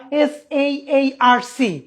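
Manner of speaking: S A R C spelt out, slow and separate; letter by letter. Shown as a demonstration. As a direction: spell out S A R C one letter at a time. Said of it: "আমি কিন্তু পড়ছি না". (S A R C আলাদা করে বানান)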